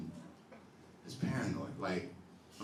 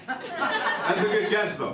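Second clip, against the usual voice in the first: laughing; 14 semitones higher, 9 dB louder, much slower than usual. Real, not acted. Praising audience guess.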